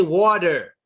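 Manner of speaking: "water" said slowly as "wah-der"; D sound. Shown as a demonstration. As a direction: put a clear D in "water". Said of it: "Use a D sound".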